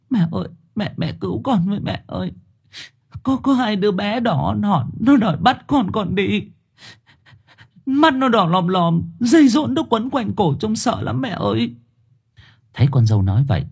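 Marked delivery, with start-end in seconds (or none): 0.1-11.7 high, tearful frightened plea; whimpering. Acted. Yến clings to mother-in-law describing the ghost children, whimpering. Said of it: "Yến ôm chầm lấy mẹ chồng thút thít".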